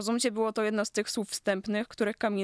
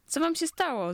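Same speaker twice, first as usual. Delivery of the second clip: higher-pitched, exasperated question; irritated; 9 semitones higher than usual. A narrator's reading. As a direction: ask it in annoyed disbelief. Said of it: "zapytała rozdrażniona Ka".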